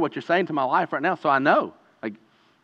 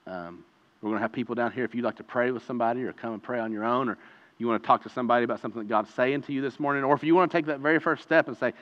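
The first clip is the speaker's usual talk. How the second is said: ordinary delivery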